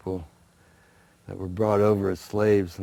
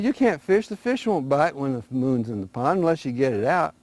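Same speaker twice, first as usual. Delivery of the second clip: rabbit voiced higher, selling the plan; a lightly voiced sly rabbit, higher and eager; scheming rabbit; 6 semitones higher, 4 dB louder than usual. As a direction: talk them into it like a sly trickster. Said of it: "He said".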